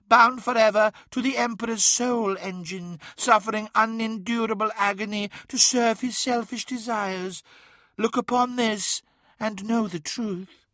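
high prophetic voice; ominous mirror daemon. A narrator's reading. Mirror shows Magnus his destiny, ominous and high. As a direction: pronounce a fate, pitched high.

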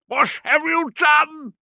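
high, loud, drawn-out accusing scream; shrieked accusation; horrified scream. A narrator's reading. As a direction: scream it at her, pitched right up. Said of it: "he screamed at her".

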